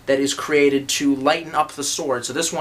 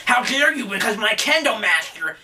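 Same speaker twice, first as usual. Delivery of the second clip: higher, indignant mock outrage; hammed-up scandalised purist; mocking offended fan; 6 semitones higher than usual. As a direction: play a huffy kendo student. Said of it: "people are saying".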